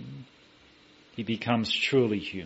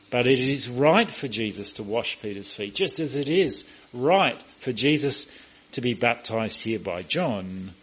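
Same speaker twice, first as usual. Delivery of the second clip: ordinary delivery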